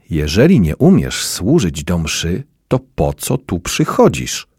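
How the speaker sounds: plain reading voice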